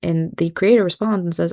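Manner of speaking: ordinary talk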